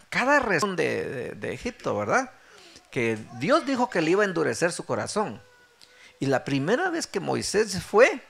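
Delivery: ordinary delivery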